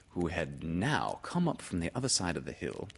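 ordinary delivery

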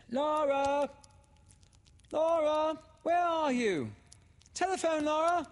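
high, loud call from the house; crying out. A narrator's reading. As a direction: holler for someone far off. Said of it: "a voice cried from the house".

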